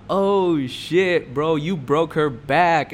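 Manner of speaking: loud, drawn-out mock amazement; imitating hyped-up friend. Friend hypes up the story to him, mock excitement. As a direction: hype it up, stretching the words. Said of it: "he was hyping the fuck out of it".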